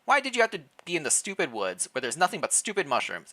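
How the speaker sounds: ordinary talk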